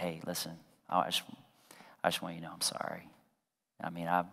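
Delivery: low, very quiet apologetic voice; tentative, hushed sorry; soft apology. Retold or imitated. Husband returning to wife to say sorry, soft and low.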